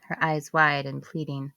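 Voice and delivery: ordinary delivery, nothing marked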